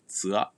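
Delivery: zua with the final a whispered; "zua" fading to a voiceless a; whispered final vowel. Shown as a demonstration. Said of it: "or be whispered".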